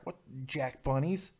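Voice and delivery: ordinary delivery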